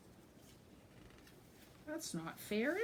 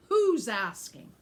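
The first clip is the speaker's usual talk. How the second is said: gruff; 3 semitones higher, 11 dB louder, slower than usual. A narrator's reading. Goat answering the ogre rudely.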